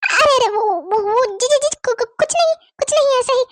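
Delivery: flustered stutter, pitch climbing at the end; stammering. Acted. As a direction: stammer badly, then hurriedly deny it. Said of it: "तुम काहे ऐसे हकला रही हो भाई".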